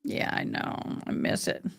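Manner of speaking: soft whispered reply to viewers. Real, not acted. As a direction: breathe it almost to herself. Replying to chat softly.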